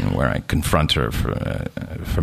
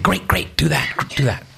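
eager agreement; 8 semitones higher than usual. Retold or imitated. Eager reply to nurse.

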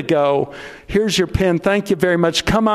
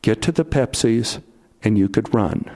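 low inner voice; 7 semitones lower than usual. Retold or imitated. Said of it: "the voice came in my ear again and said".

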